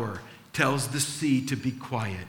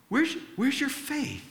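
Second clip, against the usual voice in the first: Jesus asking gently; 11 semitones higher than usual. Retold or imitated. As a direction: voice Jesus lifting it high, almost tender. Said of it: "and he goes".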